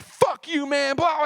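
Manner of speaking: high-pitched outburst; retold angry explosion. Retelling snapping at Colin over boxes, explosive anger. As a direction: blow up, pitch leaping high. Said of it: "I fucking exploded".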